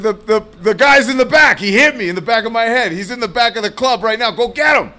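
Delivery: high, rushed report to police; acted agitated complaint; retold urgent plea. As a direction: urge the cops to move, acting it out.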